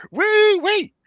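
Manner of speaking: very high, loud squealing wee; gleeful squeal. Acted. Splendiferous Finch tossed into the chair, shrieking gleefully. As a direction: squeal like a thrill ride. Captive voiced while thrown.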